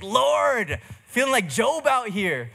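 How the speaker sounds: high, loud laughing cry to the Lord; laughing lament. Retold or imitated. Complaining to the Lord about a busy week, laughing high and loud. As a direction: moan to God through a laugh, hammed up. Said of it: "And so I was like".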